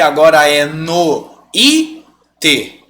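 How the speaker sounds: "no i te" in three separate beats, i made strong; wrong syllable split. Shown as a demonstration. Teacher showing the mistake, "no i te" in three stressed beats. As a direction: break it up as no, í, te. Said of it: "Se você faz dessa forma".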